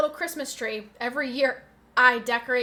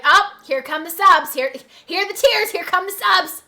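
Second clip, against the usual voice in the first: loud, higher-pitched mock hype; mock excited announcing; 6 semitones higher, 10 dB louder than usual. Streamer mimicking viewers gifting when she cries, loud mock hype. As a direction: call it out like a hyped crowd. Mimics chat reacting to her tears.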